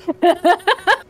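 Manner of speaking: squealing high laugh. Real, not acted. Laughing at own voice.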